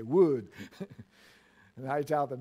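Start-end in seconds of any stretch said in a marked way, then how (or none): 0.0-0.5 "Wood" with a long oo, as wooed, Filipino style; Filipino accent. Retold or imitated. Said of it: "They can only say Wood".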